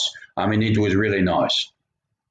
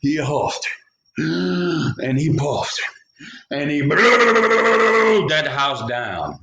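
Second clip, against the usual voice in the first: wolf blowing the house down; 5 semitones higher, much slower than usual. Acted. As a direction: stretch it out like a big breath. Narrator acts the wolf blowing.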